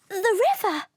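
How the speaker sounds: high, loud excited cry; gasping discovery. Acted. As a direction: burst out as she recognizes home. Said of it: "Smoky gasped".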